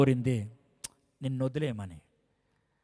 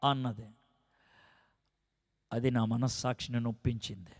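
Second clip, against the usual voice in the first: ordinary talk, nothing marked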